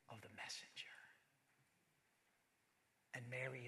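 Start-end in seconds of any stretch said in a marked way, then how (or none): 0.1-0.9 hushed, breathless whisper of wonder; a stunned inner whisper; awed whisper. Acted. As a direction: whisper it like a secret too big to say. Elizabeth's realization, voiced inwardly.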